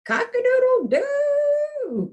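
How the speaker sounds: very high, slow, drawn-out crow; rooster crow. Acted. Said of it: "crowed Rooster".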